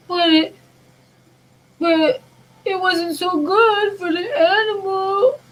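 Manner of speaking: high, loud, slow tearful wail; a child sobbing over the animal; crying child. Retold or imitated. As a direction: imitate a little girl breaking into tears. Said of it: "And she starts crying".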